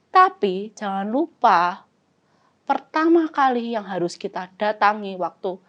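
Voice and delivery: ordinary delivery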